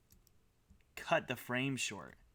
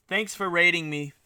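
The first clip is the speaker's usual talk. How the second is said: ordinary talk, nothing marked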